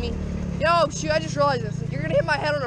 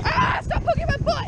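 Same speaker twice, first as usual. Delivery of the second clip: squeaky yelp, much higher; startled yelp; 14 semitones higher than usual. Real, not acted. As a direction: jump up in pitch as if poked. Poked from behind in kart.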